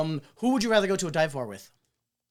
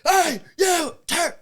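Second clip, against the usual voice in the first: sharp call, higher and louder; 14 semitones higher, 8 dB louder, much slower than usual. Retold or imitated. Veteran sergeant calling out a young officer, barking. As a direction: bark it across the base.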